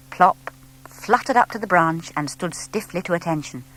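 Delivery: plain reading voice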